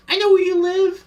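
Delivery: put-on very high, loud squeaker kid voice; little squeaker. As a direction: imitate a squeaky little kid yelling into a headset. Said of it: "Like, a little squeaker-ass voice".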